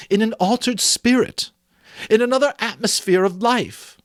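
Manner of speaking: put-on Belle, earnest young woman. Belle confronting Scrooge about his love of gain, earnest.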